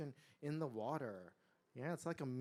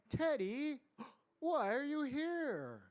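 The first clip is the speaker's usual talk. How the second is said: high, slow, swooping question; surprised asking; 11 semitones higher, 5 dB louder, slower than usual. A narrator's reading. Girl finds tiger at her door, surprised asking. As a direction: draw it out in girlish puzzlement. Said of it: "Sue asked".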